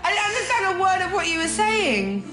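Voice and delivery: high, helpless laugh-crying; laughing hysterically. Real, not acted. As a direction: laugh till you cry. Realises prank, laughing hysterically.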